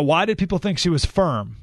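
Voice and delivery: ordinary delivery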